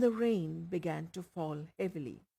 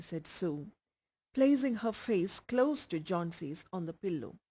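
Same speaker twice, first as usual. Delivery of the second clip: ordinary delivery, nothing marked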